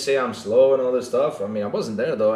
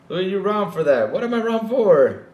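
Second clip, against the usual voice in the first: pitched-up imitation of someone objecting; mock protesting voice; 6 semitones higher, 3 dB louder than usual. Imitating the Dreamcast guy defending himself, mock indignation. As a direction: protest in a higher put-on voice.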